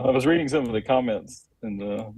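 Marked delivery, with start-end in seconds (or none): none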